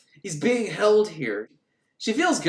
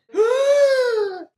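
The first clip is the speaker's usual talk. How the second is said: a sudden shrill gasp, much higher and louder; sharp high gasp; 5 dB louder than usual. Acted. Medium seized by the spirit, sudden high gasp.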